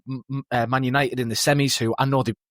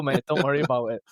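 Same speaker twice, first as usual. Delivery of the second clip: ordinary delivery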